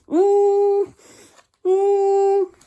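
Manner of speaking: long steady hum, high and loud. Shown as a demonstration. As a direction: hum like a bee. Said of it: "I hum out through my mouth like this".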